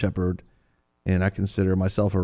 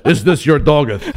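mock-heroic, higher and louder, laughing; Superman; 5 semitones higher, 5 dB louder than usual. Friend grandly questioning a little girl, mock-heroic. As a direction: puff out the chest and declaim archaically. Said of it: "like Superman throws his chest out".

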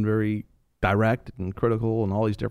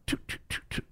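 whispered clicking choo sounds; camera shutter noise; 13 dB quieter than usual. Retold or imitated. Mimicking a camera snapping the giant's head, whispered clicks. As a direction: click out the shutter under your breath.

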